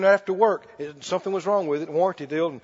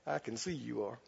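much softer and lower, kindly understanding; gentle, soft; 9 semitones lower, 19 dB quieter than usual. Retold or imitated. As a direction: hush it out like a kind aside. Speaking kindly to the mechanic.

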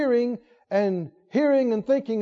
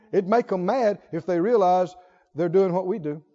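ordinary talk

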